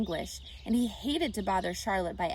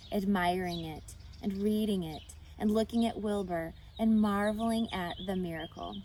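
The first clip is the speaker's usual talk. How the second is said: usual speaking voice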